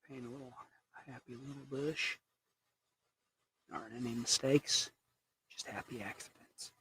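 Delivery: quiet, hushed painting-show voice, ending in a whisper; soft TV painter. Pretend. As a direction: gently soothe like a calm TV painting host. TV painter catchphrases while painting.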